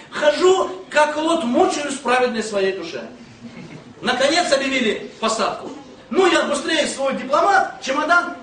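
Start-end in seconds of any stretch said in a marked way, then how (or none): none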